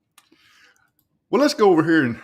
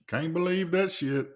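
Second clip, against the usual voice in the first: ordinary talk, nothing marked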